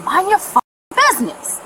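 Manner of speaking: slow, high-pitched retold snap; heated reenacted comeback; angry retort. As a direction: tell her off again, drawing the words out.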